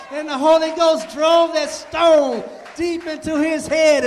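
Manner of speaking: loud, climactic sermon shouting; preaching at full force. Acted. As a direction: build the congregation to a roar.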